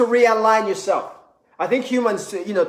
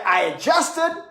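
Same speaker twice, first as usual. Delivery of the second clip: usual speaking voice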